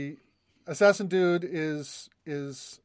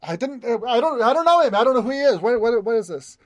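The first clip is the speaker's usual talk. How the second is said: flustered denial; 4 semitones higher than usual. Acted. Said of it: "are like".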